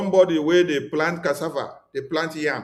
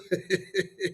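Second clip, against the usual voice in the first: short scornful laugh, soft; a quick soft laugh at them; real laugh; 8 dB quieter than usual. Real, not acted. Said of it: "You know why I'm laughing?".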